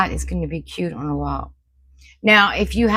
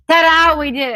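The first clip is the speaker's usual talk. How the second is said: high, loud showy reveal; excited ta-da; 9 semitones higher, 5 dB louder than usual. Real, not acted. To viewers, proudly revealing the hung decor, excited. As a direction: announce it like a magic trick. Unveiling the finished wall hanging.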